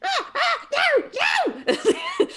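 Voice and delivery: high alarmed goblin cries; panicked goblins. Acted. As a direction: yelp it up high in fright.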